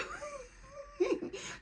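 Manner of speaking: high crying laugh; tearful joyful laugh. Real, not acted. Reacting to the positive test with a crying laugh. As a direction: laugh through happy tears.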